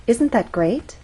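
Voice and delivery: yes-or-no question, lifting "great" at the end; rising intonation. Shown as a demonstration. Teacher asking listener to agree, rising intonation. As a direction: voice glides up on "great". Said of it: "an example of rising intonation".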